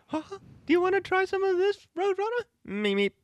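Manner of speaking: high, squeaky cartoon voice; vintage cartoon voice. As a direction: play a zany old toon. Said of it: "give us a standalone cartoon voice".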